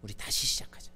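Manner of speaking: whispered, tender reassurance; soft whisper. Shown as a demonstration. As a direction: breathe it to one person. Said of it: "상재야". (이름을 부르며 속삭이는 위로)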